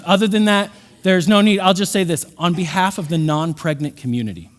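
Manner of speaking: ordinary talk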